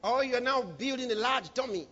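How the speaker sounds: normal talking voice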